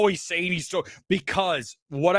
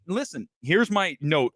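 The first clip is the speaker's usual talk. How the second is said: usual speaking voice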